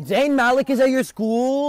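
loud, high, drawn-out amazement; loud, playful shock; excited disbelief. Real, not acted. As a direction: exclaim it slowly like a starstruck fan. Mock-amazed at a famous name.

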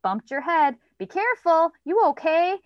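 put-on high, loud sing-out of a child's stock phrases; imitating child's script. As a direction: mimic a kid's echoed fuss after a bump. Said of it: "he had bumped his head and he would go".

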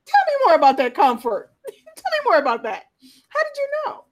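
mock eager question, rising in surprise; eager nurse. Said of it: "she turned to me and she went".

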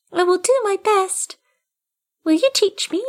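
a kitten waking to a place it knows; newborn kitten voice. A narrator's reading. Kitten asks.

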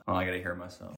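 ordinary talk, nothing marked

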